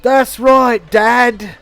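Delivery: put-on little boy. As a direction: chirp it back like a kid. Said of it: "the kid turns around and he goes".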